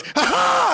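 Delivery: very high "ha ha!"; imitated big laugh. Telling how the restaurant guy greeted them, imitated high laugh. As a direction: let out a high, exaggerated mock laugh. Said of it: "this guy goes".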